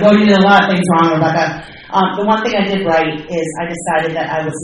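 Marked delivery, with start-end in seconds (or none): 0.0-1.5 fast and louder, teasing sponsor. Retold or imitated. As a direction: rattle it off like a sponsor ribbing her. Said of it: "She's like".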